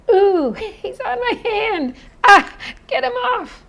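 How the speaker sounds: high, loud squeal with a laugh; playful mock panic. Teacher acting out a mosquito on her hand, mock panic.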